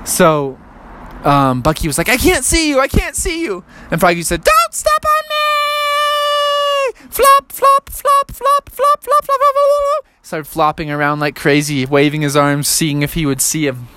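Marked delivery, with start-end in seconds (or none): 2.1-3.6 put-on searching kid. Boy searching for tiny frog. 4.4-7.0 very high and very slow stretched-out plea; tiny frog voice drawn out. Acted. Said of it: "so his voice was so high". 7.1-10.1 very high chant of flop noises; high squeaky flopping sounds. Acted. Frog flopping around.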